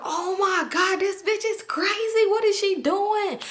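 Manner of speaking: high, slow, stretched dismay; aghast. Retold or imitated. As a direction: drag the words out in shocked dismay. Friend aghast at the girl.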